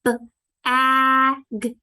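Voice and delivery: B-A-G split into separate loud sounds; sounding out. Shown as a demonstration. Teacher segmenting bag for students, loud and slow. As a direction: sound out B-A-G letter by letter. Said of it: "What about the word bag?".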